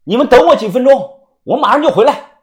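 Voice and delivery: high, loud call over the shoulder; loud hurried shout. A narrator's reading. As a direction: shout back while running. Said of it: "大声地喊".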